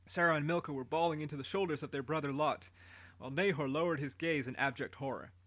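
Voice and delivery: usual speaking voice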